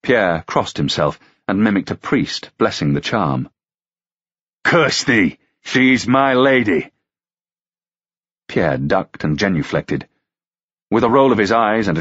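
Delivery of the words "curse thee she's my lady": higher, slow rebuke; mild anger. Acted. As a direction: scold the squire. Ruck angrily rebukes squire.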